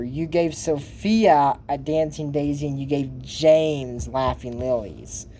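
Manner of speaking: "Sophia" and "laughing lilies" said slowly and deliberately; slow pointed correction. Real, not acted. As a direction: spell the mix-up out word by word, stressing "Sophia" and "laughing lilies". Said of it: "you're not a very good guardian teacher".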